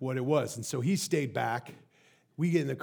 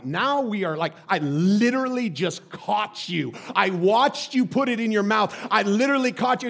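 louder, insistent accusation, building; incredulous dad; 6 semitones higher, 8 dB louder than usual. Retold or imitated. Dad confronting the daughter he caught, exasperated. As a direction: press the case, voice rising.

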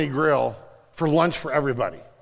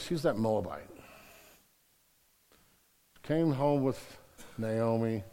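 a hint of the foreman, lower, softer and slow; foreman's reply; 6 semitones lower, 8 dB quieter than usual. Foreman answering his boss about Ruth, lightly voiced, low and soft. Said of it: "And the man goes".

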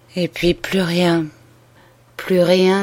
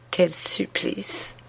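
slow breathless whisper of torment; anguished whisper; 8 dB quieter, much slower than usual. Acted. Dying sister whispers her torment, slow and breathless. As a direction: breathe it out in agony.

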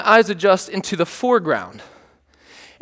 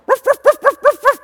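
high-pitched puppy yapping; quick run of tiny high dog barks; cute little bark. Retold or imitated. Owner imitates his puppy barking at the fence, cute little bark. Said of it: "I know, cute little bark".

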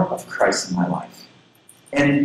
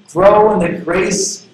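slowly, a touch louder, setting the pace; slow lead-in reading; 5 semitones higher, 5 dB louder, slower than usual. Shown as a demonstration. Inviting the congregation to read along, slow lead. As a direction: draw the verse out so the room can join. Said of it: "Can you read it with me?".

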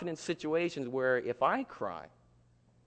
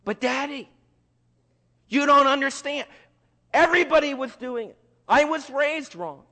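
high, loud protesting plea; pleading child; 10 semitones higher, 7 dB louder than usual. Acted. Child making excuses to Daddy, high insistent pleading. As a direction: make excuses like a child caught out. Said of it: "How many of you plea?".

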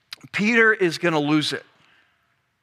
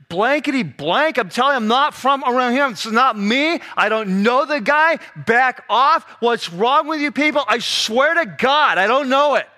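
higher, agitated mock-cursing denial; going off; 3 semitones higher than usual. Peter swears to accusers he doesn't know him, going off. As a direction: act out a man losing it, emphatic and insistent. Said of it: "he goes off".

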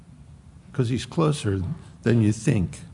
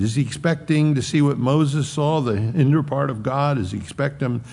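usual speaking voice

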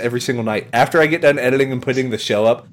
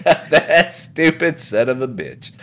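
slow, drawn-out, amused cursing; laughing gloat; slower than usual. Acted. Salesman cursing his customer, slow laughing gloat. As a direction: stretch it out through a laugh. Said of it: "He's like".

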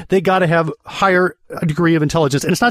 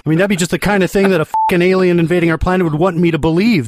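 usual speaking voice

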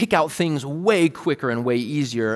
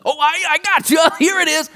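much higher, louder, mock-eager kid voice; eager older son; 8 dB louder than usual. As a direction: play the impatient big brother handing over the answer. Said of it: "what my oldest son does".

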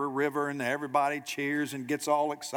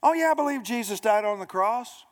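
high-pitched, mock-confident agreement; glib assurance; 8 semitones higher, 4 dB louder than usual. Person asked about faith agrees readily, glibly. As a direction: play a breezy believer who has missed it. Imitating people's glib claims of belief.

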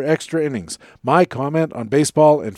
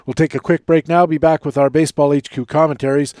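ordinary talk, nothing marked

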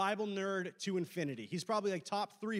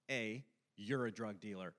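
low suspicious aside; 9 semitones lower, 5 dB quieter than usual. Retold or imitated. Mimicked exchange after weird low offer.

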